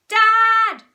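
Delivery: very loud, high yell; child's bellow for a parent; shout. A narrator's reading. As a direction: holler for Dad through the house. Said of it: "shouted Henry".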